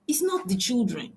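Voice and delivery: ordinary talk, nothing marked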